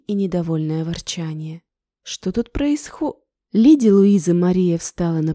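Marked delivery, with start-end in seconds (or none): 2.0-3.2 high question breaking off; cut off mid word. Acted. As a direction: stop dead mid word. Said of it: "прервалась на полуслове".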